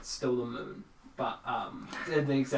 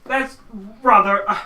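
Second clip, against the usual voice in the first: high, loud stammering farmer; flustered country-farmer character voice; startled farmer; 11 semitones higher, 17 dB louder than usual. Acted. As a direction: stumble over the greeting, loud and pitched up. Game master voices flustered farmer.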